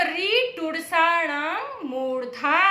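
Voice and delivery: slow sutra chant; slow recitation. Shown as a demonstration. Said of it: "सूत्र".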